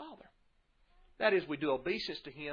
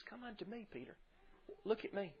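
gentle call; 8 semitones higher, 8 dB quieter than usual. Retold or imitated. Messiah calls Peter on water.

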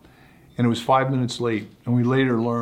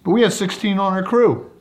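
raised, louder objection; Ted protesting to the official, raised and loud; Ted's indignant protest; 8 semitones higher, 5 dB louder than usual. Retold or imitated. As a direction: push back with your voice up high. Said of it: "Ted looks at these four and goes".

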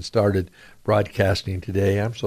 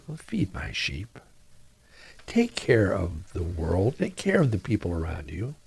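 Jesus commanding gently; 2 semitones lower, 6 dB quieter than usual. Acted. Jesus commissions Peter.

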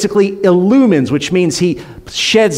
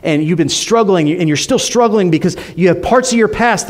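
ordinary delivery

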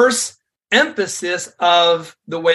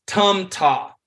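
ordinary delivery

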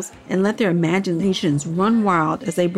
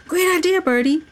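much higher, glad outburst; relieved exclamation; 10 semitones higher than usual. A narrator's reading. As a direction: cheer the idea with relief. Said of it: "Henry exclaimed, feeling relieved".

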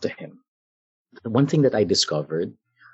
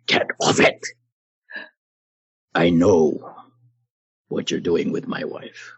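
menacing voice; 3 semitones lower than usual. Retold or imitated. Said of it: "In that voice".

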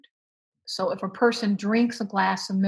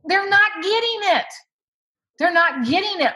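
high, loud insistence; raised voice, driving the point home; emphatic protest; 10 semitones higher, 5 dB louder than usual. Acted. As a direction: exclaim it in exasperated correction. Insistent answer to imagined parent.

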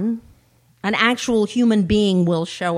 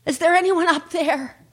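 high bleating sheep, calling upward; 8 semitones higher, 5 dB louder than usual. Acted. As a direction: call up for help with a long bleat. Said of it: "the sheep yelled upward".

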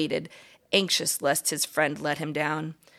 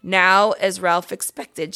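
ordinary delivery

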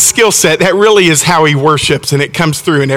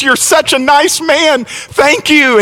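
high-pitched, lightly acted gratitude; mildly overjoyed thanks; retold gushing praise; 9 semitones higher, slower than usual. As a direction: imitate her thanking him effusively. Relieved mother hugs and thanks him.

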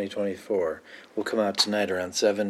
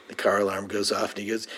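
usual speaking voice

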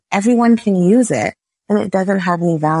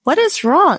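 concerned teacher; 11 semitones higher than usual. Retold or imitated. French teacher asking a crying student what's wrong, higher voice. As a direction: ask it like a puzzled teacher leaning in. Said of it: "the teacher came up and he was like".

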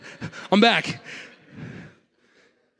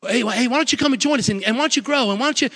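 pushy invite; 10 semitones higher, faster than usual. Acted.

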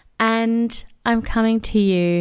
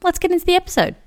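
excited segue; 11 semitones higher, much faster than usual. Acted. Cheery segue into episode.

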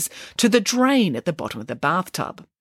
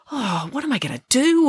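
higher, mildly fretting; a touch of worry in a raised pitch; worried; 6 semitones higher than usual. A narrator's reading. Scientist frets over the king's deadline, worried. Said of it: "worried Archimedes".

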